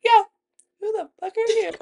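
high, small backup voice; imitated sidekick echo. Friend backing up her challenge, mimicked high and small. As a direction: mimic a meek friend chiming in.

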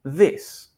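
"This" with its vowel between E and I; short lax i in "This", buzzed th first; correct vowel demo. Shown as a demonstration. Teacher illustrates the I sound, careful pronunciation model. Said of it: "suena como entre la E y la I".

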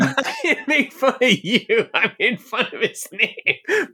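laughing through the words; giggling reply. Real, not acted. Laughing while answering.

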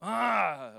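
high warning "ah" noise; imitated disapproving "ah". Holy Ghost catching you in error, mimicked disapproving "ah". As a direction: sound a sudden wordless correction. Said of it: "the Holy Ghost don't like it".

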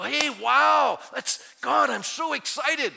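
high, loud mock-thrilled gasp; excited believer. Pastor playing a thrilled believer, excited. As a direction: act out a giddy believer.

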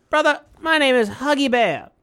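Huggy Bear voice. Retold or imitated. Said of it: "why it sounds racist when you're doing this".

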